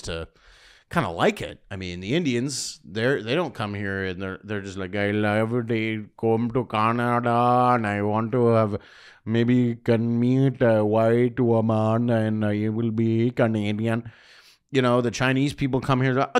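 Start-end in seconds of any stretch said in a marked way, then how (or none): 5.0-14.1 mock Indian accent, slow and lilting; exaggerated Indian accent, eager. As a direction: put on a hammed-up Indian immigrant accent. Impersonating Indian immigrant.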